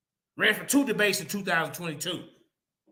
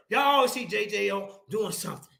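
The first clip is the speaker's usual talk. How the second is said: ordinary talk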